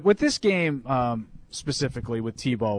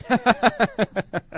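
real laugh, high and open; burst of laughter; 8 semitones higher than usual. Real, not acted. Laughs at the poem.

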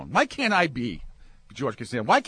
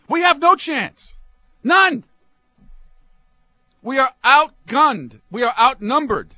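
loud rant; 7 semitones higher, 7 dB louder than usual. Real, not acted. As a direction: warn the men like it's a lost battle. Ranting warning to men.

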